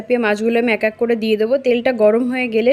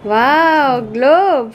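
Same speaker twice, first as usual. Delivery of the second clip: delighted surprise; 3 semitones higher than usual. Real, not acted. Mother gushing over her daughter's new glove, excited.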